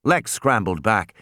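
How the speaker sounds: usual speaking voice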